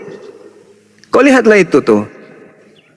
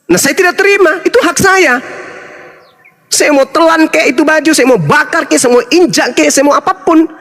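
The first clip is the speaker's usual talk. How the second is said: indignant insistence; 6 semitones higher than usual. Real, not acted. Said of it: "insisting on his right".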